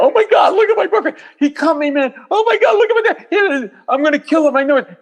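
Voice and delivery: shrieking high falsetto wail; hammed-up hysterical girlfriend cry, very high. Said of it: "girlfriend's crying out".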